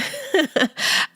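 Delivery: a quick bright laugh, real amusement; short high laugh. Real, not acted. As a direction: laugh out at the memory. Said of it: "I'm smiling because".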